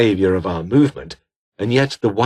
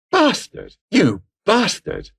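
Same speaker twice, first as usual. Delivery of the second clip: much higher, outraged snarling curse; furious cursing; 11 semitones higher, slower than usual. Acted. Betrayed man cursing the one who exposed him, furious. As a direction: spit the insult out in fury.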